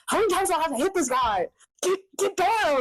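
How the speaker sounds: high, exasperated gamer complaint; retold frustration. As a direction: rush the question, then squeal the command. Reenacting frustration during boss fight.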